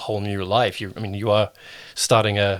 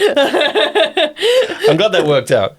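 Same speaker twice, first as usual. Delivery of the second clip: laughing; 6 dB louder, much slower than usual. Real, not acted. Laughing while speaking.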